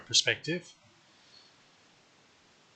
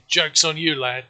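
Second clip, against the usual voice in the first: gloating; 5 semitones higher, 6 dB louder than usual. Real, not acted. Gloating at the nearly dead enemy.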